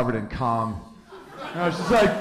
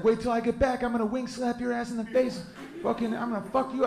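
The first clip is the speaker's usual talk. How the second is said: high, swaggering rooster threats; cocky rooster; 10 semitones higher than usual. Retold or imitated. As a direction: threaten the hens like a strutting rooster. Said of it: "He's like".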